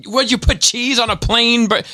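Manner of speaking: shrieking-high incredulous rant; raging mother. Retold or imitated. Irate mother yelling at the drive-through worker, very high.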